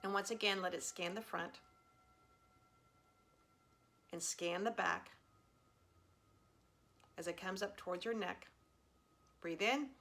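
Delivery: calm meditation guide, long pauses between cues; guided meditation. Real, not acted. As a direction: gently lead them through a body scan, leaving room to breathe. Leads a body scan.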